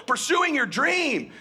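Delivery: ordinary delivery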